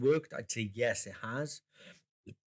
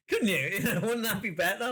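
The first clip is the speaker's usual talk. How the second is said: laughing; 8 semitones higher than usual. Real, not acted. Still laughing.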